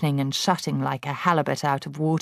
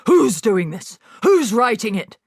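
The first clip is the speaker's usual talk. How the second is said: loud, higher demand; yelling; 6 semitones higher, 6 dB louder than usual. A narrator's reading. Headmistress yelling at nobody in particular. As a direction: yell at the whole room. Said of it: "she yelled at nobody".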